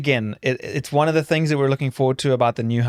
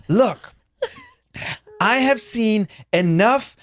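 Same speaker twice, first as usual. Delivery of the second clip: high, emphatic imitation of a rant; mock rant; 5 semitones higher, slower than usual. Co-host mimics partner's Love Island rant, mock ranting. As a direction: rant it slowly and higher, mimicking. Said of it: "you were on a rant about".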